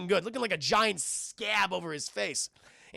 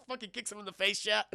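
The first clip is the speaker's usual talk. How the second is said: normal talking voice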